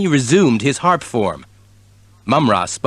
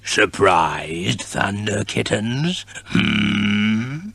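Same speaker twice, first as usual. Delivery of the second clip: villain voice; 3 semitones lower, 4 dB quieter, much slower than usual. A narrator's reading. Villain gloating.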